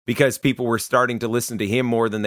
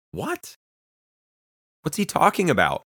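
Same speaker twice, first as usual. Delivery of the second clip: puzzled what; 7 semitones higher than usual. Pretend. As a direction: sound baffled by the words. Mock puzzled reaction to Jesus.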